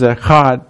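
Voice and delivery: "echad" with a throaty Hebrew ch; rasped back-of-the-throat ch in "echad"; guttural Hebrew pronunciation. Shown as a demonstration. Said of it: "I didn't clear my throat. That's just the way you say it".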